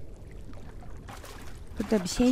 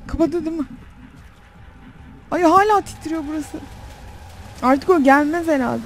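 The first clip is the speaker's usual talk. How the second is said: ordinary talk, nothing marked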